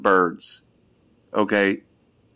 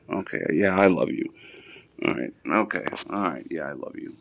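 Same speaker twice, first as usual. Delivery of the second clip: tender goodbye to the dogs, getting softer; cooing tenderness; 6 semitones lower, 8 dB quieter than usual. Real, not acted. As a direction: lower and softer, fading.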